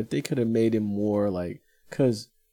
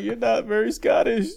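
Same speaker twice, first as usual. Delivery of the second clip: teasing, laughing; 12 semitones higher, 4 dB louder than usual. Retold or imitated. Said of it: "I was like".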